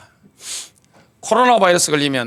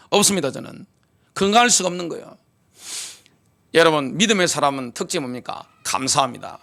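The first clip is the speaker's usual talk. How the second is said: ordinary delivery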